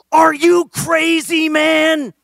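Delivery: high, drawn-out full-voiced yell; screaming in someone's face. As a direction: scream it in outrage, stretching the words. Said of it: "Joel screams in my face".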